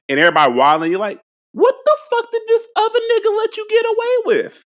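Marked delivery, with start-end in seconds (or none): 1.5-4.6 high, exasperated squeal of disbelief; exasperated mock outrage. Teacher back from a sub, scolding the wild class in outrage. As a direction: shriek it like a teacher who can't believe the mess.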